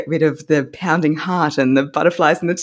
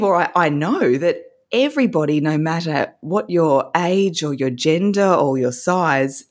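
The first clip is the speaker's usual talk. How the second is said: ordinary talk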